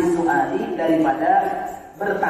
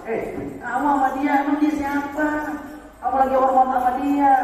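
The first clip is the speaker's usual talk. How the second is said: higher, scornful taunting voice; mocking scholars; 9 semitones higher than usual. Scholars mocking Imam Syafi'i, higher scornful taunt. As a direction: sneer at him for his respect. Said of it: "diolok-olok sama ulama-ulama besar".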